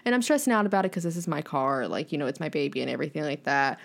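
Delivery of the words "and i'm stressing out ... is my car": normal talking voice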